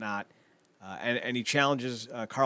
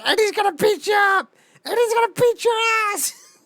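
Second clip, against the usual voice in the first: very high, loud, cartoonish tough-girl yell; squeaky mock threat; 7 dB louder than usual. Imitating Alicia yelling that her partner will beat him up. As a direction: play a tiny kid threatening a giant.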